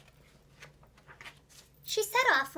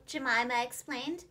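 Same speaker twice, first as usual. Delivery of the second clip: ordinary delivery, nothing marked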